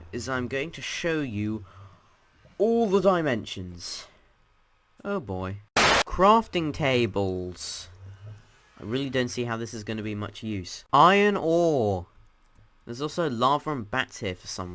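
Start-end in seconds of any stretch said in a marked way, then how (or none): 2.6-4.1 higher, louder, drawn-out announcing; big announcement. Real, not acted. Host promising viewers a tour of dimensions, grand announcement. 5.8-7.9 loud, very drawn-out announcing; announcer call. Real, not acted. Host showing viewers each dimension, loud slow announcement. 10.9-12.1 high, loud, drawn-out call; announcer call. Real, not acted. Host naming the next dimension for viewers, loud high announcement.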